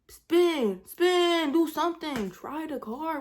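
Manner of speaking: loud, high commands, voice jumping up; urgent yelling. Retold or imitated. Telling mama to spin the block and get away, urgent yelling. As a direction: yell orders at the driver in a panic.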